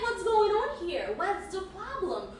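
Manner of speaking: high, slow, emphatic questioning; exasperated challenge. Retold or imitated. Speaker retells challenging friends whose kids lack Creole, exasperated. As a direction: confront them in disbelief, dragging each phrase out.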